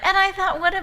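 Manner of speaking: telling the punchline's aftermath while cracking up; laughing. Real, not acted. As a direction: laugh your way through the retelling. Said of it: "We all started laughing".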